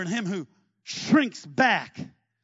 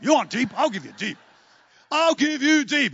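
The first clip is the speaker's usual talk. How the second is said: defiant retort; 4 semitones higher, 8 dB louder than usual. Acted. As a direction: snap back, spoiling for a fight.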